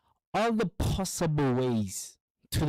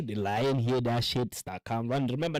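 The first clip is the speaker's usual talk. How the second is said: usual speaking voice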